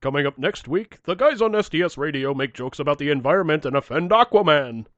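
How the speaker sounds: loud show-promo voice; hammed-up radio promo; mock promo announcer. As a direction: tease next week's show like an announcer. Mock promo for next episode.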